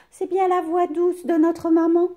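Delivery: ordinary delivery, nothing marked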